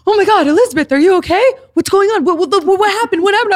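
high, loud rush of worried questions; mock panic. Stepdad hearing his daughter bawling, high loud alarm. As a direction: imitate a panicked parent firing questions.

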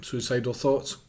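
ordinary delivery

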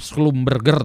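Schlumberger as "sklum-ber-ger", hard g; read as written. Shown as a demonstration. Lecturer reading a company name as spelt, "sklum-ber-ger". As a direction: pronounce every letter of Schlumberger, hard g. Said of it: "namanya Schlumberger tulisan gitu".

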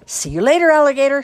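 high, loud taunting shout. A narrator's reading. Gatorman kids abandoning Jerome on the roof, shouting. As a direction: holler a gleeful goodbye. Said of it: "shouted the Gatorman kids".

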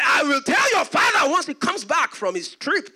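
loud, high mock threat; retold loud threat. Servant threatening to tell the father, loud and high. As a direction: threaten him at full voice.

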